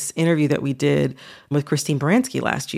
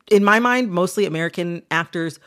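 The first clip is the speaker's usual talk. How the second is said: normal talking voice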